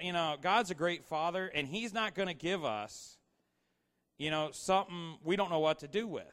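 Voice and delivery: ordinary delivery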